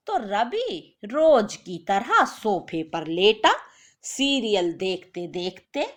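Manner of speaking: ordinary delivery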